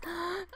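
soft delighted gasp. Real, not acted. Fan spotting a cute hairstyle, soft gasp. Sees a hair she loves.

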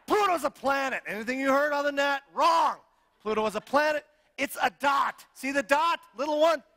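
rally the crowd in a jokey fake outrage; playful rant. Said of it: "little rant there".

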